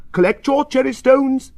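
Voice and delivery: high, loud official shout; crying out. A narrator's reading. Park keeper ordering the children, crying out. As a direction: call it out over the fence. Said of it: "he cries".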